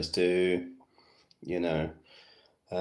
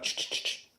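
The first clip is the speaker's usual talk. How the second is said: quick "ch-ch-ch" clicks, voiceless; imitated tapping noise; 7 dB quieter than usual. Shown as a demonstration. Showing how viewers double tap the live stream, imitated noise. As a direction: tap it out with the tongue and teeth. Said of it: "You go like".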